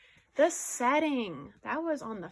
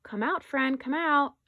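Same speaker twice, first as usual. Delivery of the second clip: higher, louder read-aloud shout; storybook shout; 5 semitones higher, 7 dB louder than usual. A narrator's reading. Mouse shouting to a hidden friend to come out, raised voice. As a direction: call out loudly to coax them out. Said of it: "Mouse starts to shout".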